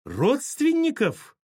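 very high, drawn-out indignant cry; enraged shriek. A narrator's reading. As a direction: shriek the word back, outraged. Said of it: "вскрикнула взбешённая Саша".

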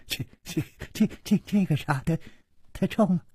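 stuttering, rising high into a question; baffled stammer. Acted. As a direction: trip over the words in disbelief.

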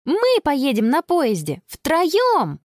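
put-on high, eager girl's voice; excited exclamation. Girl plans train trip for all three, high and excited. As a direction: announce the new plan, pitch climbing. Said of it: "воскликнула Конни".

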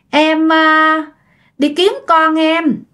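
high, drawn-out eerie voice; haunt him with slow, high-pitched words; creepy ghost woman. Acted. Ghost telling her neighbour she seeks her child, eerie and high. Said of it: "cái giọng nghe rất là rùng rợn".

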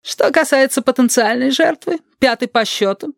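high-pitched pedantic woman; acted schoolmistress explaining a theory; lecturing Teresa. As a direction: lecture formally in a high character voice. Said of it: "продолжает Тереза".

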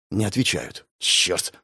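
low, quiet, voiceless curse; muttered curse. A narrator's reading. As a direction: curse under your breath. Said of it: "процедил демонолог". (процедить вполголоса)

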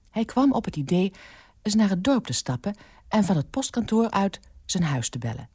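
plain reading voice

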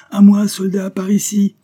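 high, loud hailing shout; calling out. A narrator's reading. Stranger waves the soldiers over to him, calling out loudly. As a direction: shout to bring them over here.

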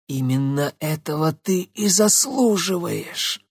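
rasped out through a strangled throat; hoarse rasp. Acted. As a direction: choke the words out hoarsely. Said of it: "прохрипела девушка". (хрипло)

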